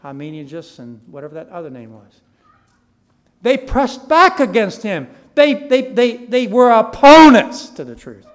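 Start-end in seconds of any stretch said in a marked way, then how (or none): 3.4-8.2 very loud, high pulpit shout; full-force emphatic preaching; impassioned preaching. Real, not acted. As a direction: shout it out, stumbling on with rising force.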